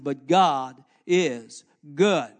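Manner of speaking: slow, loud GOD IS GOOD; emphatic. Acted. Preacher hammering the point home. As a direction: draw out every word.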